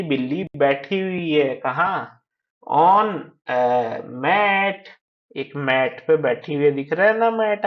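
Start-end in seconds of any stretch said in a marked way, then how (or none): 2.8-4.9 very slow, stretched words; reading out. Shown as a demonstration. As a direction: read each word separately, drawn out. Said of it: "read out करना है".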